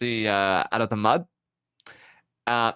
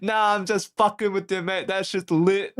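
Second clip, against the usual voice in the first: higher, joking broad Aussie; broad Aussie accent; 6 semitones higher than usual. Retold or imitated. Rescuer teasing the stranded driver, broad Aussie accent. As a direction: imitate a teasing Aussie bloke. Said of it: "broad Aussie accent".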